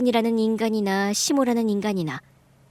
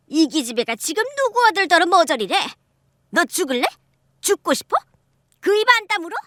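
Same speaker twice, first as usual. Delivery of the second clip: higher, louder threatening mother-in-law; 9 semitones higher, 5 dB louder than usual. Acted. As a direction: snarl threats to silence her.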